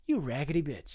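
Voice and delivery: low soft disdainful insult; muttered scorn; contemptuous. Retold or imitated. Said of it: "I was like".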